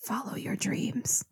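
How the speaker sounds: slow breathy whisper. Acted. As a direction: whisper it slowly. Whispered sign-off.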